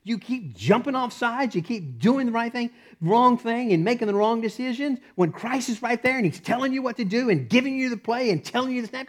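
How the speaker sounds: high-pitched exasperated heavenly onlooker; acted exasperation from on high; Gabriel. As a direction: scold in incredulous, high-pitched disbelief. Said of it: "Gabriel's up there going".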